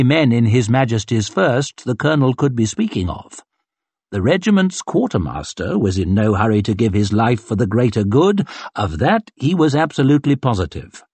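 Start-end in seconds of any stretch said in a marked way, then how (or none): none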